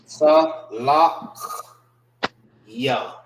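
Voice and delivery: Sa, Lach, Ya with clear gaps; syllable by syllable. Shown as a demonstration. Teacher sounding out the word, separated Sa, Lach, Ya. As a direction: separate each piece, rasping the end of Lach. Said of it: "These are pronounced together".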